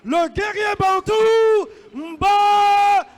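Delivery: booming, drawn-out fighter introductions; boxing ring announcer. Acted. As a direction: announce each fighter to the crowd.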